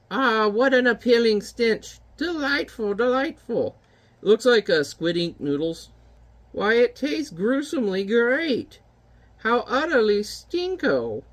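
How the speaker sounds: high, slow witchy voice; witch voice. Acted. Witch relishing the stinky soot in her pot, high slow voice. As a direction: savour the stench like a cackling witch. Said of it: "These witches are, like, my favorite characters".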